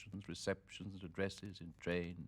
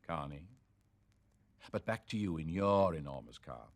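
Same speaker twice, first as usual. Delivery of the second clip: plain reading voice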